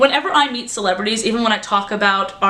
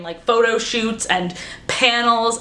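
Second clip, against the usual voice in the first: normal talking voice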